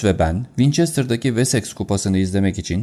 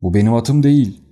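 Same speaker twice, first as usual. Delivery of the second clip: loud, higher protesting shout; 4 semitones higher, 7 dB louder than usual. Acted. Colonel protesting to Holmes about the horse, loud shout. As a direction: shout it in disbelief. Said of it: "diye bağırdı albay".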